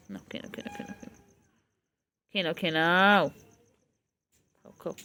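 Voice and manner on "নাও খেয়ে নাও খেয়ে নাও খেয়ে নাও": tiny high whispered coo; coaxing squeak. Real, not acted. Owner urging her pet to eat, high whispered coaxing. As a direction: coax the little one to eat in a squeaky whisper.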